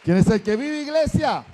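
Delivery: loud rising call to the church; rally the congregation with a shout; a preacher's loud call to the church. Acted.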